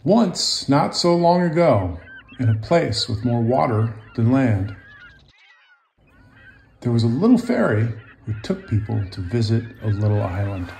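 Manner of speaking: lively picture-book narration; storybook read-aloud voice; reading to children. A narrator's reading. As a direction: tell it gently to young listeners.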